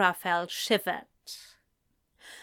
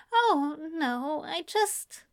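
higher, halting and flustered; flustered stammer; 6 semitones higher than usual. Acted. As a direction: stumble over the words, caught off guard. Aziraphale flustered by flirting.

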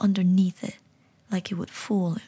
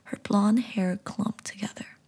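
usual speaking voice

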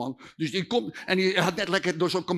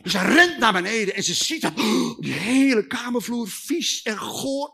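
ordinary delivery

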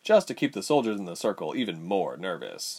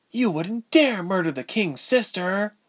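put-on defiant young woman; 11 semitones higher, 3 dB louder than usual. A narrator's reading. Ilse defies the general.

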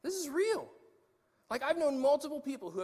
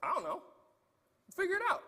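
breezy dismissal; 12 semitones higher, 4 dB louder, faster than usual. Retold or imitated. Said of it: "I was like".

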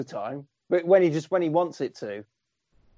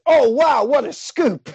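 mock excited, higher and louder; hammed-up eager cub reporter, higher and louder; excited Jimmy Olsen; 8 semitones higher, 5 dB louder, slower than usual. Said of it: "And Jimmy Olsen says".

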